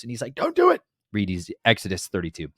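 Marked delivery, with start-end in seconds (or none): 0.3-0.8 much higher, louder frantic plea; mock desperate plea; pleading Moses. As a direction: beg him to stop, voice jumping way up.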